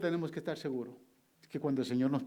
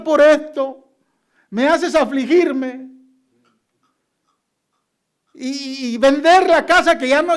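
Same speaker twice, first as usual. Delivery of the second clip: angry at God; 12 semitones higher, 14 dB louder than usual. Retold or imitated. A pastor retelling how he complained to God, loud and indignant. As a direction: rail at God for the wasted trouble. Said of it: "me enojé con Dios".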